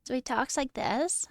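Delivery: put-on half-hearted go at a Valley Girl accent; a hint of Valley Girl upspeak; attempted Valley Girl. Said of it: "I was like Valley Girl".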